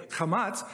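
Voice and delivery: chamatz with a throaty ch; Hebrew vowel name. Shown as a demonstration. Teacher naming the Hebrew vowel for the class. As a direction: say chamatz carefully. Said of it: "the first vowel there is called".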